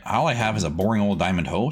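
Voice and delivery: usual speaking voice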